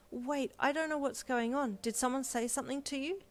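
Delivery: worried. A narrator's reading. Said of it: "Deku asked worriedly".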